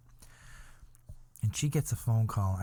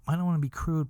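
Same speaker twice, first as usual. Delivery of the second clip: ordinary delivery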